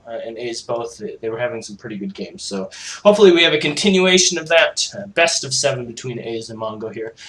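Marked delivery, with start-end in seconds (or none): none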